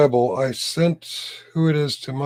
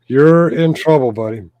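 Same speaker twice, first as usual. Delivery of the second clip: loud teasing scold; mock stern warning; 8 dB louder than usual. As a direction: warn him loudly, half joking. Mock scolding a chat member.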